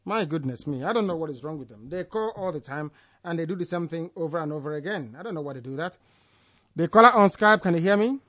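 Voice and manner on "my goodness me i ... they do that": soft, fast grumbling aside; exasperated muttering. Real, not acted. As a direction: grumble under your breath.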